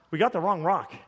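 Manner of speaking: low, deflated repeat; resigned. Retold or imitated. Pastor acts out accepting the bad news, resigned. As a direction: let the protest drop into acted resignation. Same words, sinking into acceptance.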